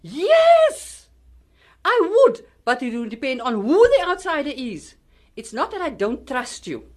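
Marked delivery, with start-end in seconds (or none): 0.0-2.4 high, loud burst of yes; emphatic yes. Acted. Mother firmly answers her teen daughter, loud and high. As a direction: fire the answer straight back at her.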